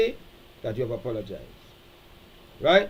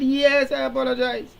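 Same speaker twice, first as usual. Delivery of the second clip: impatient mock apology, much higher and louder; dismissive apology; 9 semitones higher, 4 dB louder than usual. Partner snapping back a grudging apology, high and loud. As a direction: toss off a fed-up sorry. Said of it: "And you said".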